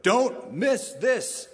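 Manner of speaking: mock all-caps emphasis, drawn out; all caps shout. Shown as a demonstration. Preacher acting out an all-caps note, slow and emphatic. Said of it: "write all caps".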